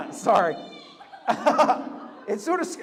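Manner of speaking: real laughter breaking into the words; laughing. Real, not acted. Host apologizing to the crowd for cracking up, laughing. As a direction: apologize through helpless laughter.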